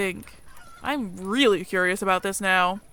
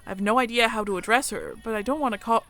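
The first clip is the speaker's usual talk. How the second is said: normal talking voice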